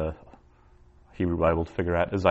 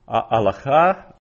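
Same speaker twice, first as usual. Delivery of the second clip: ordinary talk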